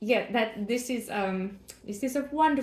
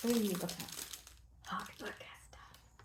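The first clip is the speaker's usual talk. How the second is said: slow proud whisper; 2 semitones lower, 10 dB quieter, slower than usual. Real, not acted. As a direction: whisper with quiet pride. Showing off a roll.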